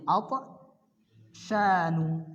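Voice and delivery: atsyanu stretched very slowly, every syllable held; slow teacher demo of atsyanu; drawn-out model word. Shown as a demonstration. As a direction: draw atsyanu out long for students to copy. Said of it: "maka ikut ini".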